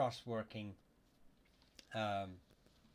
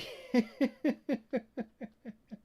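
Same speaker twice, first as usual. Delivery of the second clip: loud high laugh; 13 semitones higher, 8 dB louder than usual. Real, not acted. Laughs at viewer's begging comment.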